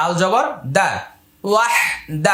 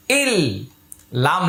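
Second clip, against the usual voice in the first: usual speaking voice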